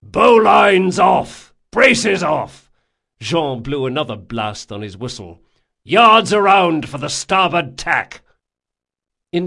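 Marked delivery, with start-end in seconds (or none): 0.0-2.7 loud, high deck commands; shouted orders. A narrator's reading. First mate to invisible deckhands, shouted. 5.8-8.2 loud, high deck command; shouted order. A narrator's reading. First mate after his whistle, shouted.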